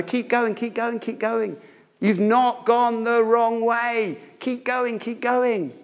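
high, drawn-out cheering on; urgent encouragement. Acted. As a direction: urge them along like a runner's supporter. Preacher voices urging each other on.

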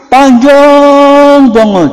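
exaggerated drawn-out phrase; mock overlong. Shown as a demonstration. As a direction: drag it out to mock a long amin. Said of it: "Dan nggak usah".

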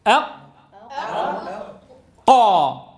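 brake on ق in أق, then burst into قا; doubled qaf. Shown as a demonstration. Teacher drills the class, locked then released ق in قا. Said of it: "وقف، واضرب فرامل".

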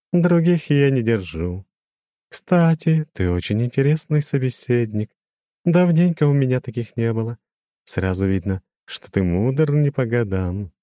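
lofty demon with a glassy ring; crystal ringing voice. A narrator's reading. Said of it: "прозвенел он". (высокий звенящий хрустальный голос демона)

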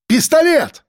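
high urgent yell; shout. A narrator's reading. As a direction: cry out the warning. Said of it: "крикнул я". (громкий крик)

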